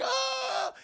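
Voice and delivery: very high mock scream; short cartoon scream of alarm; startled scream. Max screams before crashing.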